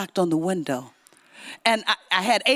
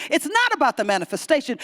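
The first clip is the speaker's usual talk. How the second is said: ordinary talk, nothing marked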